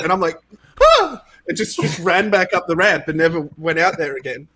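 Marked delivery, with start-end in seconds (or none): 0.8-1.2 a sudden high yelp of panic, hammed-up; mock scream. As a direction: shriek like you've just been scared off stage. Panics on the ramp.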